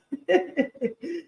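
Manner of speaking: high, soft giggle; light self-conscious chuckle after apologizing; little high-pitched laugh. Real, not acted.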